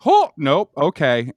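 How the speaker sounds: startled oh nope; mock alarmed recoil; surprised. Guy on a hotel toilet, recalling the shock, surprised.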